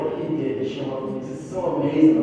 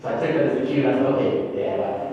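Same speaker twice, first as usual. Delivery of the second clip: normal talking voice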